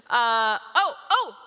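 sudden realization. Retold or imitated. Son realizing he can get the water himself, startled oh. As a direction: catch on all at once.

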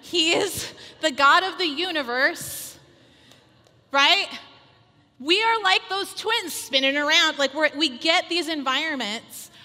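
loud, high exhortation; rally the women to trust God; impassioned sermon push; fervent preaching. Acted. Preaching at full force.

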